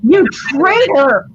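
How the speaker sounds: loud, slow mock outrage; hammed-up accusing holler; mock accusation. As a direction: playfully cry betrayal, drawing it out.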